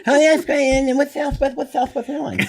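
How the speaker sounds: high laughing mock Leno; puzzled Jay, giggling; imitating Jay Leno, laughing; Jay Leno impression. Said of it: "Jay reacting to Southwest ticket".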